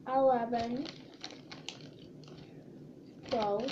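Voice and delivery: counting softly while chewing; mouth full of hot food. Real, not acted. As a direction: mumble the numbers through spicy chips. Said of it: "my mouth is on fire".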